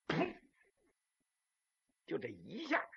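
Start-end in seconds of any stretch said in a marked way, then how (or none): 0.1-0.4 short explosive pop; imitated bang. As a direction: mouth the shot going off. Imitates weapon firing.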